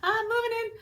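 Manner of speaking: very high, swooning mock voice of her past self; mock dreamy past self. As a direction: squeal it up high like a giddy daydreamer. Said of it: "it was probably like".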